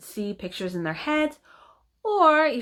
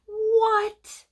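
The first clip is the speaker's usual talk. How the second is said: high-pitched, stunned "what"; stunned disbelief. Retold or imitated. Reacting to news that people see pictures in their heads, stunned. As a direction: blurt it out as if your mind is blown.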